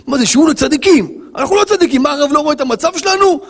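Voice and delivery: high-pitched mock audience complaint; protesting listeners. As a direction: whine back like an indignant congregant. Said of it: "אני יודע מה אנשים אומרים פה עכשיו".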